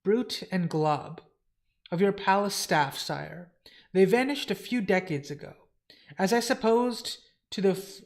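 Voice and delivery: formal dialogue, grave lord and earnest servant; dramatic comic reading. Acted. Servant reporting census news to his lord, formal and grave. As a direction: read it as stately fantasy dialogue.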